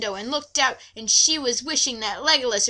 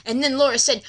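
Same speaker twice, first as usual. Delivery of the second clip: ordinary delivery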